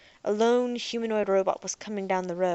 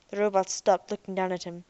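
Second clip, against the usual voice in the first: ordinary delivery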